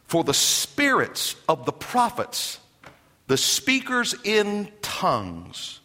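slow, deliberate reading of Paul's words; slow scripture reading. Real, not acted. Pastor reading scripture to the congregation, slow and deliberate. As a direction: lay each phrase down slowly. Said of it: "reading scripture aloud slowly".

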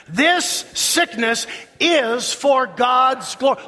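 ringing call to recite together; leading congregation recitation. Shown as a demonstration. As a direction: lead the crowd word by deliberate word. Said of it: "Say that with me".

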